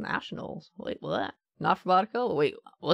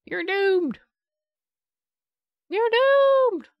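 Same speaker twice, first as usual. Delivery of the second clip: high-pitched wail of doom, louder the second time; mock doom sayer; 13 semitones higher, 5 dB louder, much slower than usual. Player mimicking the game's many sounds, high mock doom cry. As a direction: cry it out high and drawn, hammed-up. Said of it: "All of them being like".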